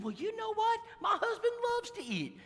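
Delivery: put-on high, sweet older lady voice; preacher voicing the visitor Ellen, high and sweet. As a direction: play her as a sweet lady.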